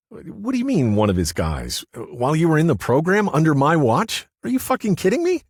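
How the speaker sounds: Larry blows up at Paul over Witsec, outraged and loud; furious disbelief. Acted.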